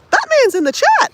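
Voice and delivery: Batman's in the chat squealed with glee; delighted squeal. Real, not acted. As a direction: announce it like big news. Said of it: "repeats excitedly".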